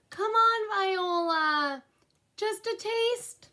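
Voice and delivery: put-on high, slow pleading child voice; little sister pleading. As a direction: beg for a taste like a little kid. Little sister begging for icing.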